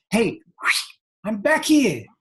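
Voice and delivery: high mock holler breaking into laughter; happiness calling out. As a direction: call for attention in a high comic voice. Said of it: "happiness is looking out of his eyes going".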